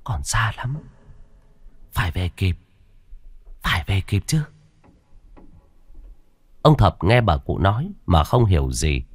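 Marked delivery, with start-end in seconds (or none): 0.0-4.4 hushed grandmother short of breath; faint, winded old woman's voice; feeble breathless murmur. A narrator's reading. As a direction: whisper it weakly, in short separated bursts. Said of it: "giọng thều thào như hụt hơi".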